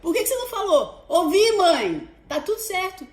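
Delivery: high, fast indignant reply; exasperated retort. Retold or imitated. Mother retorting to daughter, high and exasperated. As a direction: fire back, fed up. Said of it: "Eu falei".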